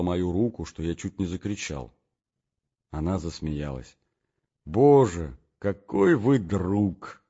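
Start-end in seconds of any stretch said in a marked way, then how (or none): 4.6-7.2 slow, heartfelt exclamation; girl's exclamation. Acted. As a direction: draw out the words tenderly. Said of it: "начала она через минуту серьёзно". (медленное, прочувствованное восклицание)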